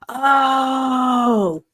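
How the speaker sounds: long, high drawn-out "oh"; dawning realisation. Real, not acted. Host suddenly remembering the restaurant incident, dawning realisation. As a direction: let a high "oh" stretch as it clicks. Sudden recollection while reading chat.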